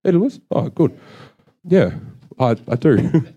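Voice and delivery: high, questioning check; doubting whether the word exists; wondering if solidarity is real, then relieved; unsure of the word. Real, not acted. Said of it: "That's not a word".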